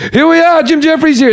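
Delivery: high-pitched, hyped DJ parody; over-the-top radio host. Retold or imitated. Comic mocking Mexican radio shows, over-the-top DJ shout-out. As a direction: announce the guest way up high, hammed up. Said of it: "over the top".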